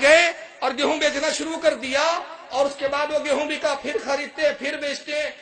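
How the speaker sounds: loud, high preaching at full force; fervent loud pulpit storytelling; rousing sermon. Acted. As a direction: lift the crowd towards the story's triumphant end. Preacher builds to climax.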